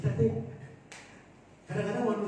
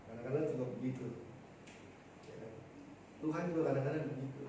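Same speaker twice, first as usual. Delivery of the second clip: ordinary talk, nothing marked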